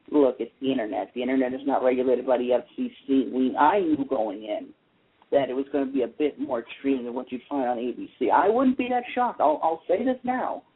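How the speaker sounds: normal talking voice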